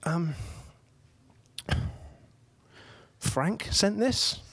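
mock child actor fumbling for the forgotten line; hesitant little boy. Third little boy at the manger, hesitantly questioning. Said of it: "and pauses and says".

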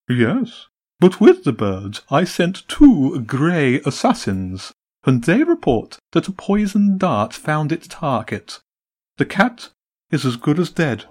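high, jolly pigeon greeting; a sly bird host, all bonhomie. A narrator's reading. Pigeon boss greeting the Empress, high and jolly.

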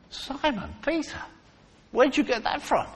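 high, hushed amazement; astonished onlooker. Acted. Voicing astonished onlooker questioning Peter.

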